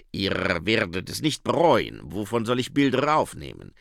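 put-on accented vampire character voice; Otto Schreck. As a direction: promise it as the keen vampire. Vampire promises and asks in story. (Vampirstimme mit Akzent)

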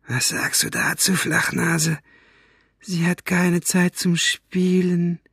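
high, slow, menacing villain voice; mocking villain. A narrator's reading. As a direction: throw her excuse back with slow mockery. Villain mocks her words. (spöttisch gedehnte, hohe Schurkenstimme)

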